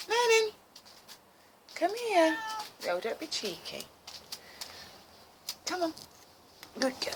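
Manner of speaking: high, sing-song call to a pet; calling the cat. Real, not acted. Calling her cat again.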